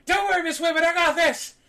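high, strained guard voice; security guard. Acted. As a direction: reassure her in a high, hollered guard voice. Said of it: "he goes".

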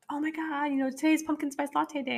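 high, mocking kid voice; imitating Michael. Mom mimics her son's latte excitement, high kid voice. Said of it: "Michael's like".

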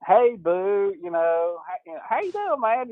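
a hint of an eager girl reporter opening an interview; young reporter. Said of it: "So she's like".

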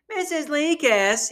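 high, slow, drawn-out whine; mock whiny voice. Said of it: "one more whiny voice go".

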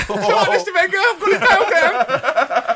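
very high, loud comic panic; hammed-up squeaky plea to the boss; panicky corporal. Corporal calls to Mister Wenger, high comic panic.

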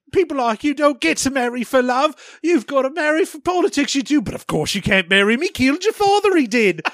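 high, lilting British maid; fake British accent. Maid lecturing the princess on marriage, fake British accent. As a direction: ham up a British servant's accent. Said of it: "She's doing a fake British accent".

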